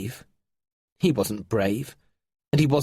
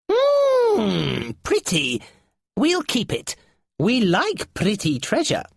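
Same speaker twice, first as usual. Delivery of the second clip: greedy creature; 10 semitones higher, 3 dB louder, slower than usual. A narrator's reading. Nylar admiring stolen armlet.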